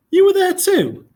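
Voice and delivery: high shocked disbelief; sudden high-pitched shock; surprised. A narrator's reading. Boy shocked at parents.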